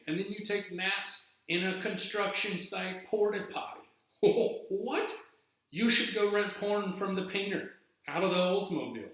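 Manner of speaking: high, loud, outraged disbelief; incredulous rant. Retold or imitated. Berating the gross coworker in disbelief.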